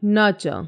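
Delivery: nurture with ch for ture, r silent. Shown as a demonstration. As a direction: model nurture as NUH-chuh, falling. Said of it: "च हो जाती है".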